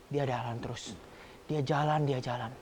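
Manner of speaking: hushed, drained telling of the walk; trailing narration. A narrator's reading. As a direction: let each step die out. Trudging toward father's house.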